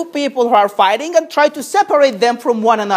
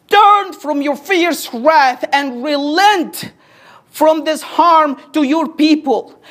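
slow plea; slower than usual. Acted. Slow pleading scripture.

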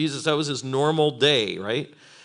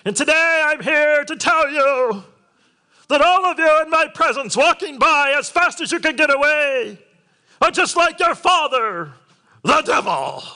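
much higher, loud, hammed-up street-corner preacher; mock street preacher; 13 semitones higher, 6 dB louder than usual. Pastor mocking a street preacher denouncing passers-by, hammed-up and loud. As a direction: bellow at passers-by like a sidewalk evangelist. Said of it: "Can you imagine witnessing like that on the street?".

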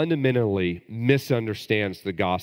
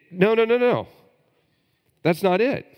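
much higher and louder, firm rejection; emphatic no; 12 semitones higher, 5 dB louder than usual. Real, not acted. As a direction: cry out no, no, no from the pulpit. Preacher rejecting works-based salvation.

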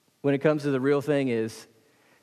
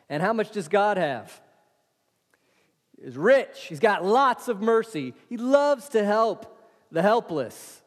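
higher, fervent preacher's delivery; full-force preaching; 6 semitones higher, 3 dB louder than usual. Acted. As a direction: preach it out to the congregation. Preacher's rising fervour.

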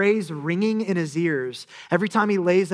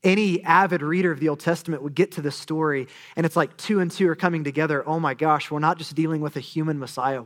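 ordinary talk, nothing marked

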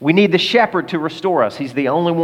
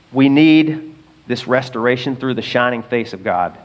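ordinary talk

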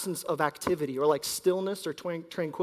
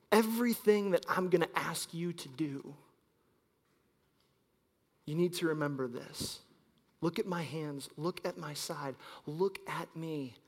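tender Jesus; 6 dB quieter than usual. Acted. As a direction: quietly beg them to look. Jesus's imagined words, soft.

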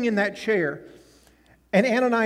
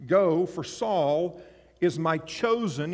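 slow solemn reading; 2 semitones lower, slower than usual. Shown as a demonstration. Preacher reading the Lord's command to Ananias, slow and solemn. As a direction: lay each word down slowly. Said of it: "you might want to underline that word".

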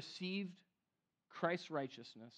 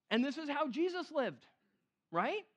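usual speaking voice

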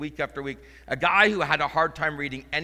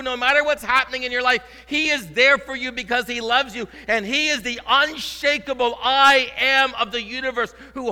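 much higher and louder, ringing proclamation; climactic preaching; 11 semitones higher, 10 dB louder than usual. Acted. Pastor proclaiming Emmanuel to the church, loud soaring preaching. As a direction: lift it to a soaring declaration.

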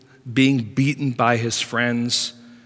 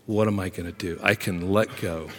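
hushed despair; 6 semitones lower, 7 dB quieter, faster than usual. Acted. Job's inner voice, hushed.

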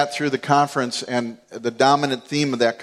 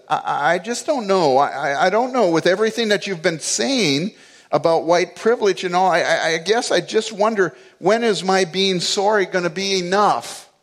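quivering, near tears; 6 semitones higher than usual. Retold or imitated. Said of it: "her voice is really quivering now".

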